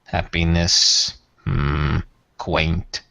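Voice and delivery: low, slow, aloof alien lord; Tyrath voice. Acted. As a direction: speak down to them, coldly measured. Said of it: "Tyrath replies".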